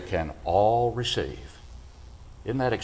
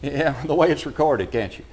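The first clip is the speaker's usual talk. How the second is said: ordinary talk, nothing marked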